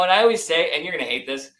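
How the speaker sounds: ordinary delivery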